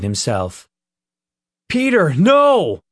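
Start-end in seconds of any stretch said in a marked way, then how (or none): 1.7-2.8 loud high desperate cry. A narrator's reading. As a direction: scream it to stop him. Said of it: "Nick cried".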